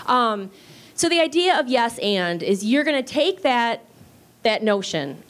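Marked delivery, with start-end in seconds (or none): none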